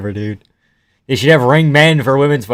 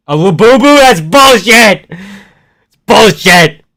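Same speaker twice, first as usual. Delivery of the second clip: higher, loud, slow outraged protest; indignant yelling; 7 semitones higher, 7 dB louder, slower than usual. Real, not acted. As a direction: protest it loudly, fully fed up. Outraged at the fighter's outfit.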